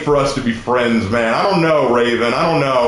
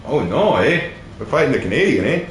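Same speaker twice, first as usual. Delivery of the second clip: mock Canadian; 3 semitones lower than usual. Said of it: "you're fighting a Canadian".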